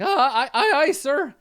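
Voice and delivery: high, loud, laughing aye-aye; flustered wizard. Acted. Skeleton wizard accepting orders, high flustered. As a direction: squeak out a fumbling salute. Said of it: "Fezwick's like".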